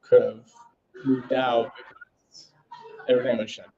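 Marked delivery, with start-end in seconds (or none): none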